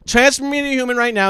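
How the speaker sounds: mock angry demand. Repeated demand.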